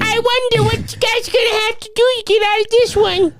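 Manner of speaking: taunting villain voice. Retold or imitated.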